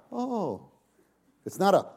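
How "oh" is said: a small, soft oh; a throwaway oh; wrong oh. Shown as a demonstration. Preacher showing what Paul's oh is not, soft.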